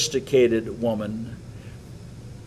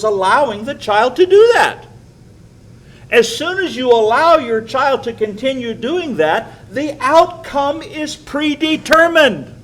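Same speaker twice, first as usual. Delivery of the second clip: rising emphatic preaching; 11 semitones higher, 5 dB louder than usual. Real, not acted. Preacher building to his point.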